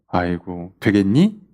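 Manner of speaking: low, sneering "아이고, 되겠니?"; mocking scoffer. Preacher imitating scoffers who deny the Second Coming, low and sneering. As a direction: scoff like a cynic who won't believe. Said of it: "이렇게 얘기한단 말이에요".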